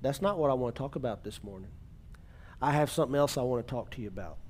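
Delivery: soft, fast inner voice of God; hushed, hurried divine voice; quiet still voice. Retold or imitated. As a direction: speak it low and quick, like a voice deep down. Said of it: "in that quiet, still voice".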